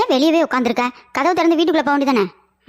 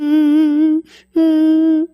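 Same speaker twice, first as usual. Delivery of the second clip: put-on child whimpering; 3 dB louder, much slower than usual. Child whimpering after pinch.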